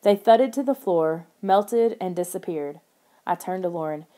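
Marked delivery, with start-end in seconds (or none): none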